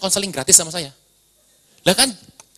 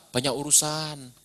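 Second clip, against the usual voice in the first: mock excuse; 7 semitones lower, 11 dB quieter, slower than usual. Mocking a lame excuse.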